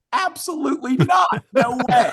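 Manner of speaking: high, loud hammed-up refusal; mock refusal shout. Mock-recalling refusing the saxophonist.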